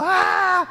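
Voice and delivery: a high, loud, scared scream; disciples' terrified scream. Retold or imitated. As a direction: scream like frightened fishermen at a ghost. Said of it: "todos gritando".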